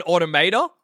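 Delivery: "Automata" as auto-MAY-duh; American pronunciation. Shown as a demonstration. Host checks which version is American, "Automata" as auto-MAY-duh. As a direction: ask it with MAY stressed in "Automata". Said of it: "Is the American one".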